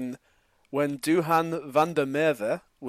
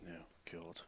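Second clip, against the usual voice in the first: whispered dread; 8 semitones lower, 26 dB quieter than usual. Real, not acted. Dreading a hard quiz question.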